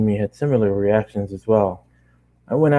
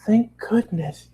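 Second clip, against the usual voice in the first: Fluttershy relief; 12 semitones higher, much slower than usual. A narrator's reading. Fluttershy relieved about the portal, high and slow. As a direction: breathe out relief gently. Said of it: "Fluttershy said".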